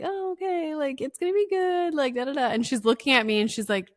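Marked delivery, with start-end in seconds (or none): 0.0-2.5 much higher, sing-out stalling voice; stalling reassurance. Retold or imitated. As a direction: reenact a parent stalling, pitched way up. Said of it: "stalling I'm like".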